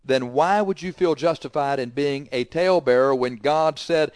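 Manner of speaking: ordinary delivery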